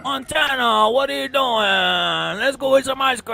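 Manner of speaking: high, loud coach pleading with his fighter; slow, drawn-out corner coach nagging; Teofimo Senior impression. Corner coach urging Montana Love to fight, loud and high. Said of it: "needs Teofimo Senior in the corner to motivate her".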